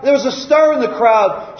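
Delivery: ordinary talk, nothing marked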